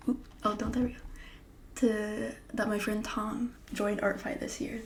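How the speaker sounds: ordinary talk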